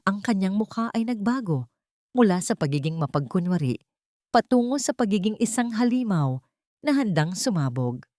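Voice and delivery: plain reading voice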